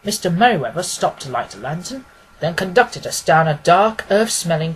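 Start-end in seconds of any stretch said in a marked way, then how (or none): none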